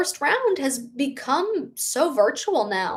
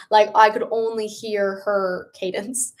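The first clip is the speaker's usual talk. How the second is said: normal talking voice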